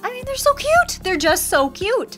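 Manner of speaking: high squeal of delight; gushing. Real, not acted. Vlogger gushing over the plushies, high squeal. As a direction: melt over how cute they are.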